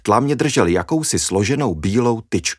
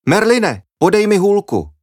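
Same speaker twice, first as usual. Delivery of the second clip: high, loud call across the garden; 12 semitones higher, 5 dB louder than usual. Acted. The girl calls her dog Merlin to bring her cane, loud call.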